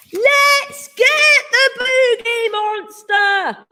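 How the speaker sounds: high, slow drawn-out shout; rally the others to attack. A narrator's reading. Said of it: "shouted the littlest one".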